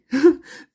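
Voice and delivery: loud high burst of laughter; loud rising cackle. Real, not acted. Hisashi cackles.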